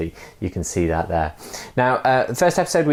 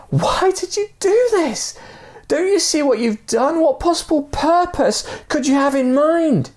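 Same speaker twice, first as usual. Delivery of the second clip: desperate shout. Acted. Man shouting down with rope tied round him, high desperate shout. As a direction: demand an answer in despair. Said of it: "shouted to the guy".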